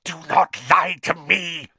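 loud, fierce hiss; venomous command. A narrator's reading. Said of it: "hissed the second voice".

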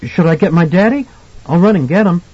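high, anxious offer of help; alarmed child. A narrator's reading. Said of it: "more alarmed".

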